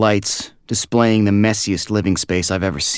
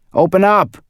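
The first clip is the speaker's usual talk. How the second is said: much higher, louder pounding-door shout; 12 semitones higher, 7 dB louder than usual. A narrator's reading. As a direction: yell it through the door. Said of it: "a voice shouting".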